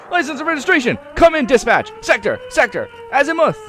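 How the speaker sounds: high, loud frantic dispatch yelling; screaming into mouthpiece. A narrator's reading. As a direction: scream disjointed police calls. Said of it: "screams in bloody, in the bloody mouthpiece".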